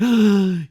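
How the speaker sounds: mock gasp. Mock shock at the Queen's family.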